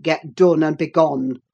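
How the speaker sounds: low-pitched angry master; stern dismissal. A narrator's reading. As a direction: order her off in a deep voice. Heathcliff orders her out.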